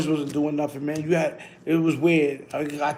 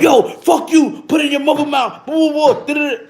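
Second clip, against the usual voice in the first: imitating a heated cuss-out, higher and louder; mock angry outburst; 9 semitones higher, 5 dB louder than usual. Retelling a friend cussing out commenters, mock angry. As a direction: fire off insults in a high, loud mock rant.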